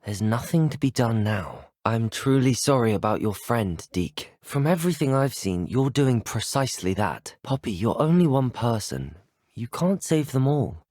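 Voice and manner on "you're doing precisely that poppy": ordinary talk, nothing marked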